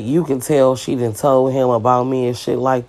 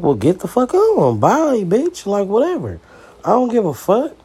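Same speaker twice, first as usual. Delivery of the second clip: high, heated retold brush-off; mock angry send-off; 6 semitones higher than usual. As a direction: send her off with a sneering bye. Retold fight, telling her leave.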